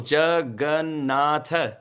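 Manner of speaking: "Jagannatha" spoken slowly, each of its four syllables laid out clearly; all four syllables. Shown as a demonstration. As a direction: spell out "Jagannatha" syllable by syllable, unhurried. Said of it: "The four syllables".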